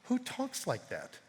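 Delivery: soft, slow, acted wonder; hushed fearful awe. Disciples after the storm obeys, hushed fearful awe. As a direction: ask it slowly, as if awestruck. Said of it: "they were filled with fear and said".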